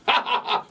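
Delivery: mock amused laughter; imitated laugh. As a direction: act out a friend's hearty laugh. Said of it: "She goes".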